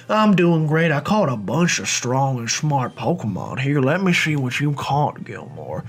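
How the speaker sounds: put-on a light Southern accent for the rival; drawn-out Southern rival, slow. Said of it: "what kind of Southern accent I wanna give him".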